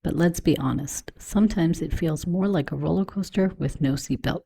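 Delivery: upbeat host read over music; podcast intro read. Acted. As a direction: sell the show like a polished promo.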